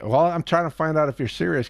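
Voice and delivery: usual speaking voice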